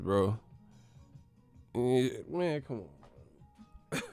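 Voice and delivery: normal talking voice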